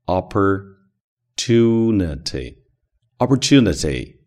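slow American Opportunity, curled r in the por; Opportunity with the American retroflex r, drawn out; American pronunciation. Shown as a demonstration. Teacher offering the American rhotic Opportunity, slow American. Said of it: "当然也可以发美式发音，卷舌音".

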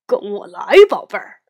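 lightly voiced big papa bear; a hint of a hearty bear dad.

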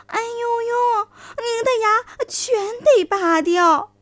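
high, slow, mock-alarmed fox; fox exclaiming. A narrator's reading. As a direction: cry out in false alarm. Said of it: "就叫了起来". (狐狸夸张地惊叫)

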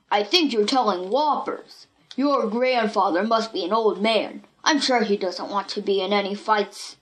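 a lightly voiced boy Buck, doubtful. A narrator's reading. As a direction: Buck scoffing in his own steady voice. Buck's gruff held voice.

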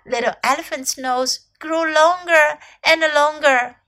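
usual speaking voice

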